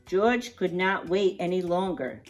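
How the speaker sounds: plain reading voice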